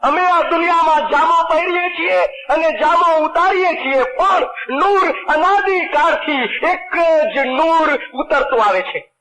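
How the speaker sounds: proclaiming the Imam. Retold or imitated. Preacher voicing the Imam's decree to the congregation, high and slow. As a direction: lift the Imam's words up high and draw them out slowly. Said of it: "એટલે ઇમામ ફરમાવે છે".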